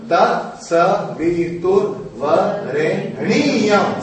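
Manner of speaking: Tat Savitur Varenyam drawn out very slowly; slowly elongated chant. Shown as a demonstration. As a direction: stretch Varenyam to its full length. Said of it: "Now hear this".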